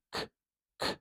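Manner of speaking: "k" as a bare voiceless click of sound; isolated first sound. Shown as a demonstration. Teacher starting to transcribe the word, isolated sound. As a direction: release just the "k", no vowel after it. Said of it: "start with the first sound".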